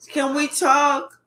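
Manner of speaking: slow, wheedling appeal; mock pleading. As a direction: act out an ex begging to talk. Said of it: "I'm begging you".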